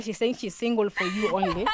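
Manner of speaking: ordinary talk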